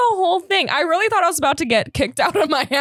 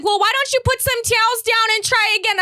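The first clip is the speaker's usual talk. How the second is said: high, breezy mock-mom voice; imitated mom; 6 semitones higher than usual. Mom advising daughter about towels, imitated in high voice. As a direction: suggest it in a raised mom impression. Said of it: "my mom's like".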